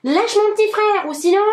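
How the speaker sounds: big brother yelling at the bully; shouting. A narrator's reading. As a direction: yell to protect the little brother.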